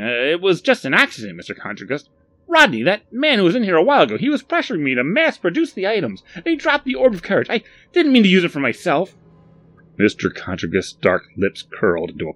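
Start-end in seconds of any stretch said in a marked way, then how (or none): 0.0-9.2 loud, high, flustered excuses; anxious explaining. A narrator's reading. Barry explains the accident to Mister Contragust, anxious. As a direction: plead innocence in a fluster. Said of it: "Barry tried to explain".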